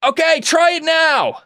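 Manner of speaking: high, drawn-out distant shout; calling up from below. Acted. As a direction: holler it up to the cockpit. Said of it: "maybe you hear from below, uh, Geralt say".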